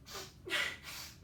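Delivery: high breathy fake sob; a put-on tearful sigh. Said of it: "I threw on my fake tears".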